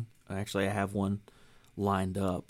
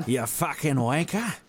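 higher, slow English-accented insult; mock English accent; 6 semitones higher, 3 dB louder than usual. Shown as a demonstration. Host demonstrating accents for co-host, mock English accent. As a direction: draw it out in a put-on English accent. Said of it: "English accent or, like".

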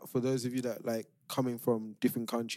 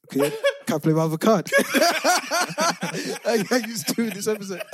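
high-pitched laughing through the words; 10 semitones higher, 8 dB louder than usual. Real, not acted. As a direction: crack up while trying to finish. Caught out, laughing through outro.

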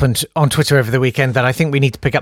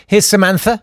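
normal talking voice

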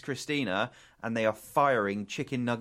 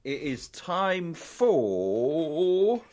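slow, drawn-out, high announcer call; title announcer; 7 semitones higher, 6 dB louder, much slower than usual. Shown as a demonstration. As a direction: boom out the segment title like a ring announcer.

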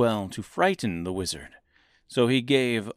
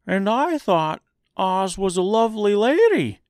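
high, louder strawman voice; Scarecrow voice; 11 semitones higher, 5 dB louder than usual. A narrator's reading. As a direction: voice the wondering Scarecrow.

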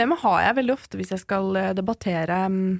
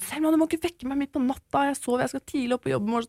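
higher, fast, imitating an irritated mum woken at night; mock the mother scolding her daughter, higher and quicker; annoyed mother; 7 semitones higher than usual. Mother woken at night scolds her daughter, high and quick. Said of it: "Hun var sånn".

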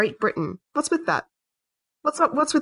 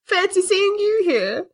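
mock ghost; 8 semitones higher, slower than usual.

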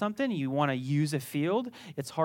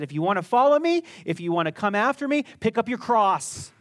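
louder, higher call to follow; preaching Jesus' words; 4 semitones higher, 6 dB louder than usual. Acted. Preacher quoting Jesus to the congregation, loud and rousing. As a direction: proclaim it like a summons. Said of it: "Jesus said".

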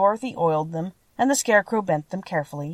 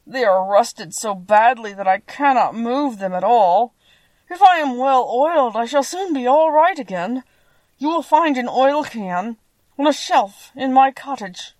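sad rusted tin man; 5 semitones higher, 6 dB louder than usual. A narrator's reading. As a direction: lift the pitch and stretch the words plaintively. Voices the rusted Tin Woodman.

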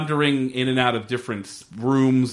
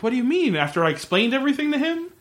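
much higher, incredulous "What do you mean?"; imitating shocked disbelief; 13 semitones higher, faster than usual. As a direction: pitch it way up in mock disbelief. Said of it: "And Michelle's like".

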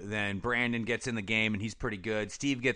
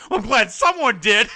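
high, loud hammed-up snap; a teenage girl's hammed-up snap at her dad over dinner; mock angry outburst; 12 semitones higher, 11 dB louder than usual. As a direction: ham up a bratty retort. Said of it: "Brenda gets all mad".

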